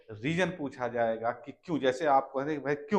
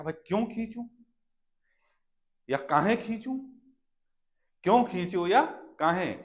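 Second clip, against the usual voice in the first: higher, whiny why should I; mock objection; 5 semitones higher, 3 dB louder than usual. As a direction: complain like a reluctant child. Acted pupil objecting.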